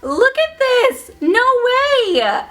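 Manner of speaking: high, loud exasperated outburst; mad shouting. Real, not acted. Vlogger venting at viewers about her nails, mad and shouting. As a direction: let it burst out at the camera, fed up. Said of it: "I feel like I'm shouting at the camera 'cause I'm so mad".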